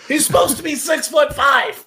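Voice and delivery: high, loud, laughing shout; laughing mock shout. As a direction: yell it through laughter.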